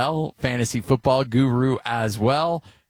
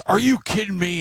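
retold scream; 10 semitones higher than usual. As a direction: imitate the son's outburst in a mild, high yell. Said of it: "and just screams it out".

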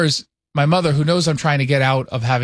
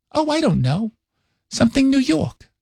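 vague, higher New York mom impression; mother's New York accent; 7 semitones higher than usual. Mother requests photo from son, higher New York accent. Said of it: "talks like this all the time".

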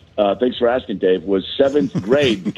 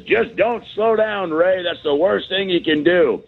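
barking gym coach; 6 semitones higher than usual. Retold or imitated. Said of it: "He says".

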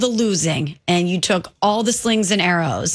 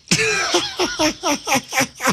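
burst of laughter; 9 semitones higher than usual. Real, not acted. Laughs at the irony.

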